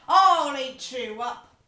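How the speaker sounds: growling threat. Acted. Max threatening his mother.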